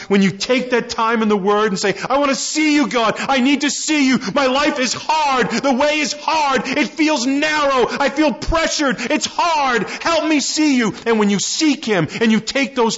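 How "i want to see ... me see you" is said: pleading prayer. Acted. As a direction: voice a worn believer begging God to be seen. Praying believer's plea, voiced.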